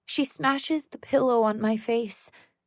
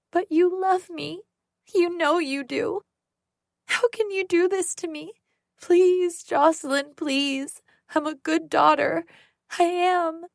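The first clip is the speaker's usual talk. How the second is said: put-on high, tearful childlike pleading; sobbing like a little girl; 7 semitones higher than usual. Sarah sobbing on the floor, begging Jocelyn in a high childlike voice. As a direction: beg like a small child caught crying. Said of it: "She sounds like a little girl".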